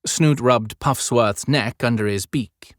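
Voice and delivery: usual speaking voice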